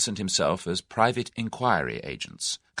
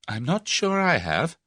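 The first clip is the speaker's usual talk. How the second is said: higher and slow, a mock-ominous hint; dark hint; 10 semitones higher than usual. A narrator's reading. Tuppence teasing Tommy about still being a blackmailer, mock dark. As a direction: tease him with a sinister aside. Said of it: "said Tuppence darkly".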